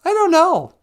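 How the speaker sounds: high, loud mock protest; comic high-pitched wail of bafflement; exasperated outburst. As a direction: yell it helplessly, pitch way up. Caller protests when asked about lost accent.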